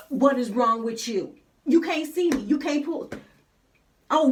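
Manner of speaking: loud, high-pitched ranting at other drivers; road rage. Retold or imitated. Passenger going off at bad drivers, acted-out road rage. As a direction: go all in, acting out a furious road-rage tirade. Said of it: "just going in. And my road rage".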